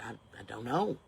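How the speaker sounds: mock bewildered shopper; meek mumble. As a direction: shrink back and mumble it. Her meek reply.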